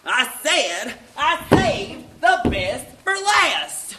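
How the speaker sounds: loud, slow, emphatic shout; yelling. Acted. Announcer repeating for crowd who missed it, slow yell. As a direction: yell it word by word so nobody can miss it. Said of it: "You don't have to yell".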